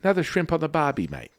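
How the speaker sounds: fast, high, loud hammed-up Aussie accent; mock Australian. Host proving his Australian accent, hammed-up fast Aussie. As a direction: rattle off a cartoon Australian catchphrase. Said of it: "not Australian".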